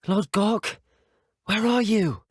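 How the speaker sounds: high-pitched searching call. Acted. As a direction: call out into the mine for someone missing. Searching mine, calling out for Lodgok.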